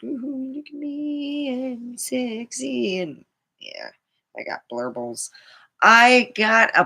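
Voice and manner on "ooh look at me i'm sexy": high, slow preening voice; mock sexy self. Telling viewers how she once strutted, high mock preen. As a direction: coo it like showing off in the mirror. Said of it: "and I was like".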